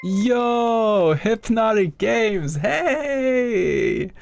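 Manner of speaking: high, drawn-out happy holler; excited greeting. Real, not acted. Streamer welcoming a fellow streamer to the chat, excited greeting. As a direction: hail a friend with long, soaring vowels.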